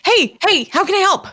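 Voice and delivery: bright eager offer to help. Acted. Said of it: "And she's like".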